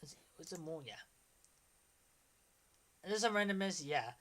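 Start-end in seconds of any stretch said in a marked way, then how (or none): 0.0-0.9 whispered, to himself; soft whispered search. Real, not acted. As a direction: murmur it in a whisper. Unvoiced aside.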